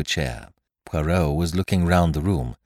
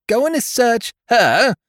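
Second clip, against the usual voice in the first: high, loud, slow outraged demand; indignant demand; 7 dB louder, slower than usual. A narrator's reading. Chambermaid demanding Celestine be searched, outraged.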